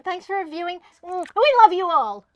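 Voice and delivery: ordinary talk, nothing marked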